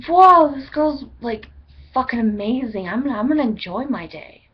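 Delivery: loud, high gush easing into sing-song; mock amazement. Playing a viewer amazed by the video, hammed-up delight. Imagining a thrilled viewer's reaction.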